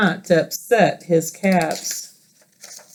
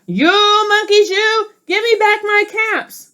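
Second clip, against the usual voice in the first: angry peddler; 12 semitones higher than usual. Acted. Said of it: "This made the peddler angry".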